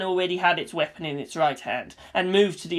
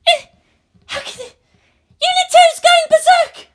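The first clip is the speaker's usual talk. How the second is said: very high, louder alarm; rising panic to a shriek; panicked shriek; 10 dB louder than usual. A narrator's reading. Maya panicking.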